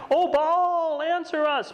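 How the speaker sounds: high, loud desperate plea to an idol; crying out. Acted. Prophets of Baal crying out to Baal, high and loud. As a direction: wail it up high as if begging a silent god.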